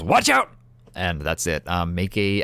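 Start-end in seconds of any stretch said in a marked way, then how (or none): 0.0-0.5 high loud shout; yelled warning. Acted. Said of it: "he just yells".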